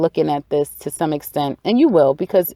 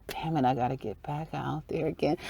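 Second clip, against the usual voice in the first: much softer, grudging complaint; quiet grumble; 4 semitones lower, 12 dB quieter than usual. Retold or imitated. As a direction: drop the volume and grumble it. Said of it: "It's like".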